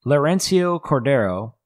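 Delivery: ordinary talk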